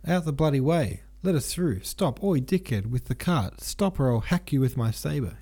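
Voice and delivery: high, heated mock yelling at the soldiers; angry shouting. A narrator's reading. Denisov clears the bridge with his sabre, high mock yelling. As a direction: bark orders at the jammed cart, hammed-up. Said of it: "he shouted".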